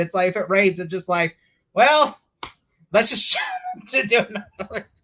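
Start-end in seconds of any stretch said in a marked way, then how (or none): 1.7-5.0 high, loud put-on voice; hammed-up clueless decision maker; mock broadcaster. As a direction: breezily improvise to fill airtime, mockingly. Mocking broadcasters filling time.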